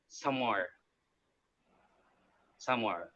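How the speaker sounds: "Samar" said as locals say it, evenly and softly; local pronunciation. Shown as a demonstration. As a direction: demonstrate "Samar" quietly, one careful take after another. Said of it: "people actually say that".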